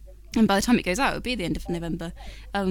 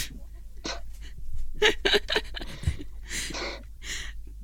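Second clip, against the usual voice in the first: 1 silent laugh; 12 semitones higher, 6 dB quieter than usual. Real, not acted. Silent laughing at the Dutch joke.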